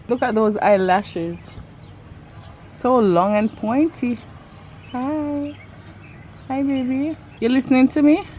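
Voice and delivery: high, sweet coo to the horse. Real, not acted. Talking to the horse.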